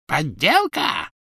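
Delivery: slow, high croak. A narrator's reading. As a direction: croak the word suspiciously. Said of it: "хрипло каркнула Белатриса".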